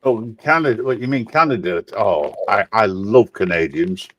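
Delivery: ordinary delivery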